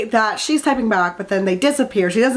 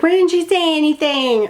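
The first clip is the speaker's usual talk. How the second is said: voiced higher, a mocking friend; mock whiny friend; 6 semitones higher than usual. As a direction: whine it like a nagging friend. Mocking friend's quote in recap.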